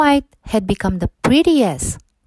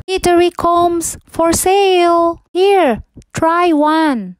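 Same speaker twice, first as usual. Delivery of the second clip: much higher, louder sing-song seller; young girl calling out; 10 semitones higher, 4 dB louder, slower than usual. A narrator's reading. Disguised queen as a girl selling combs, sweet calling. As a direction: call out your wares sweetly. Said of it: "heard a young girl called out".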